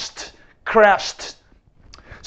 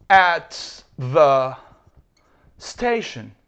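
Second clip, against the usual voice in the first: slow, careful English model read; slow model sentence; much slower than usual. Shown as a demonstration. Teacher modelling a sentence slowly for students. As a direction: spell it out slowly for the class. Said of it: "Cuando yo digo".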